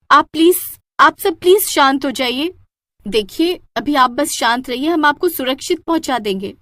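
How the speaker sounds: calm panicking passengers urgently; urgent pleading. Acted. Hostess calming panicked passengers.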